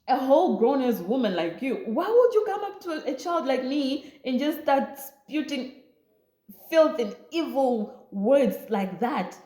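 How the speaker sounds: defending herself to the woman, heated; indignant retort. Retold or imitated. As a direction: tell off the grown woman. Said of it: "I was like".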